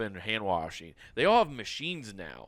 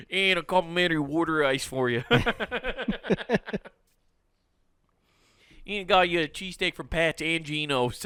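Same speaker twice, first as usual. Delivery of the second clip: put-on Philly accent; 4 semitones higher than usual. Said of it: "that dirty Philly accent".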